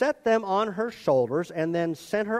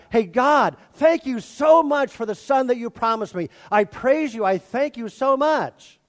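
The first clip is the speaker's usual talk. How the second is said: higher-pitched, gushing mock thanks; Abraham's eager praise; 5 semitones higher, 4 dB louder than usual. Abraham thanking God for the promised son, eagerly grateful. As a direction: play an over-grateful man praising God. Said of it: "Abraham goes to God".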